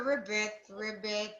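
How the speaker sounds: low, soft frog ribbits; frog croak. Shown as a demonstration. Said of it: "Frog says".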